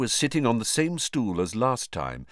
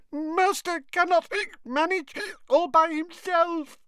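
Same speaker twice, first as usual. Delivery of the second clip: high elf voice, tearful and hiccuping; mild whimper between hiccups. A narrator's reading. Drunk Winky pleads for her missing master, whimpering.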